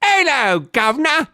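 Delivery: high, loud cockney-style British greeting; British accent. Acted. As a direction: put on a big, silly British accent. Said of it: "read it in a British accent".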